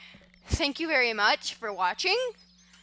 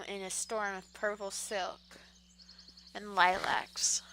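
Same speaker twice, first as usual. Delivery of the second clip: ordinary delivery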